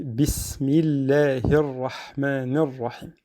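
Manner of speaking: bismillahir rahmanir rahim recited slowly with a light L after the i. Shown as a demonstration. Teacher modelling the rule for students, slow light bismillahir. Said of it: "Et on dit".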